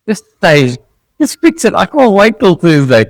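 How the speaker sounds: high, desperate mock plea; pleading customer. Customer begging him to stay, high pleading voice. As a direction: whine like a stranded customer begging. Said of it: "I can just see him".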